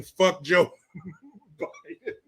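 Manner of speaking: breaking up with laughter mid-phrase; slow down and crack up before the last word; can't get the name out for laughing. Real, not acted. Cracks up quoting the crowd's chant.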